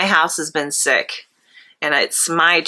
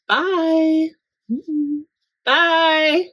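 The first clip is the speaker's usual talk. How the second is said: playful, much higher goodbyes; high sing-song bye; 10 semitones higher, much slower than usual. Real, not acted. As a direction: chirp each bye up high. Sing-song goodbyes at video end.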